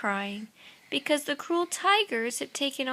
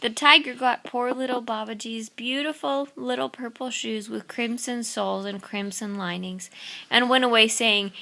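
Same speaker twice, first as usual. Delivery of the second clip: ordinary delivery, nothing marked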